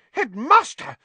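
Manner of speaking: high, loud outraged splutter. A narrator's reading. Nurse told to leave, spluttering. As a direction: splutter in indignant protest. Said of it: "spluttered Madam Pumphrey".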